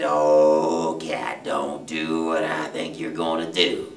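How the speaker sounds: low, slow, loud dog voice; warning dog. Acted. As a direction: warn the cat with drawn-out dread. Dog warns treed cat.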